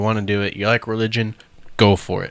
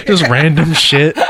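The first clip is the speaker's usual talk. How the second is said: loud laughing through words; 6 semitones higher, 9 dB louder, slower than usual. Real, not acted. Joking with cohost about sauce packets, laughing. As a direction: crack up mid-line.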